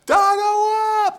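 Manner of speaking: slow, drawn-out high scream of "Dargo up!"; echoing scream. Retold or imitated. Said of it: "this echo screams across the studio".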